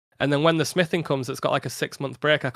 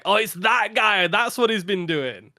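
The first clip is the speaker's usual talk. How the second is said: impressed viewer voice; 5 semitones higher, slower than usual. Retold or imitated. Imagining viewers recognizing him.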